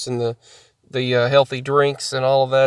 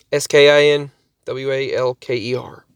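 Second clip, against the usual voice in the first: ordinary talk, nothing marked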